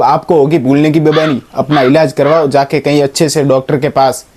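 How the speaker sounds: normal talking voice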